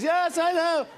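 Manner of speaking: very high, loud caricature reply; mock comedian voice. Seinfeld answering the host's bit, high mock voice. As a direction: squawk it back in a hammed-up impression.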